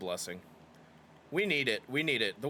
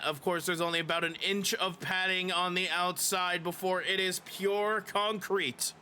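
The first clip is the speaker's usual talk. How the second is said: lively play-by-play announcer voice; wrestling commentator; 7 semitones higher than usual. Acted. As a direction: call the match like a wrestling commentator.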